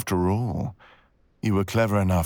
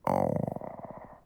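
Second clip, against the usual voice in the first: ordinary delivery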